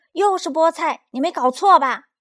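put-on high, loud child's protest; indignant shout. A narrator's reading. Child shouting at dad over spinach for dinner, indignant shout. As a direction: yell it in disbelief. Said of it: "他冲着爸爸叫道".

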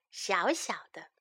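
low, soft whispered gloss; tiny whisper. Shown as a demonstration. Teacher shows children what tiny means, soft whisper. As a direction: make the word small with a whisper.

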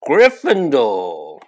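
sorting hat announcing the house to the hall; hat shouts. Acted. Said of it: "hear the hat shout the last word".

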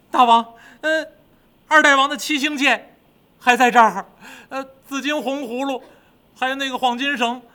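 high-pitched, hesitant underling; high, halting servant voice; timid demon minion. Acted. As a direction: report nervously to your king. Minion reports to the king.

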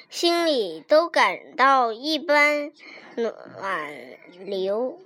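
slow, fading almost to nothing; hushed tenderness. A narrator's reading. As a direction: let it sink softly, word by word. Tender moment in the story.

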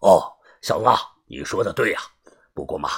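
a hint of a sinister boss; grim boss. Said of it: "帮主阴着脸说".

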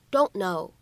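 "don't know" with the t left unreleased; incomplete plosion. Shown as a demonstration. Teacher showing learners "don't know" with no released t. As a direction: swallow the t of "don't", straight into "know". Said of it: "可以有一个不完全爆破的处理".

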